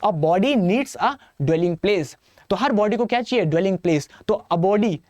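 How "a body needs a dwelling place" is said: very slow, word by word; slow mnemonic. Shown as a demonstration. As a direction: spell it out slowly for students. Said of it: "मेरे साथ बोलो". (धीरे-धीरे एक-एक शब्द पर ज़ोर)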